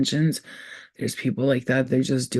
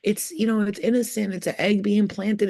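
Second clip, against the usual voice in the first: higher voice, mocking the excuse; sing-song parody of naive people; lightly mocking the excuses of others; mocking defense; 6 semitones higher than usual. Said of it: "You could all be like".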